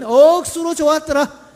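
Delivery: loud, high Gyeongsang dialect cheer; emphatic, slow Gyeongsang dialect demo. Shown as a demonstration. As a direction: punch it out in Gyeongsang style, stretching 억수로. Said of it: "경상도 버전 들어가면".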